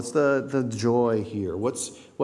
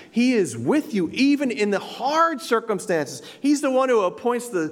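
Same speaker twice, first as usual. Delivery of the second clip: preaching at full force; 8 semitones higher than usual. Acted. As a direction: build to the sermon's climax. Preaching climax.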